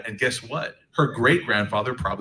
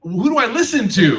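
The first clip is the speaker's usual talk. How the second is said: exasperated son; 12 semitones higher, 9 dB louder than usual. Retold or imitated. Caught between parents.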